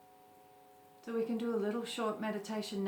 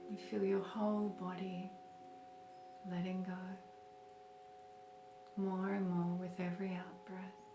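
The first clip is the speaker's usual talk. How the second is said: guided meditation; 7 dB quieter than usual. Acted. Teacher leading listeners through a short breathing meditation, calm guided meditation. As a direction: gently guide them into stillness, unhurried.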